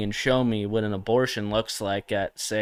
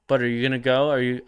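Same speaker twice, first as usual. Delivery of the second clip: ordinary delivery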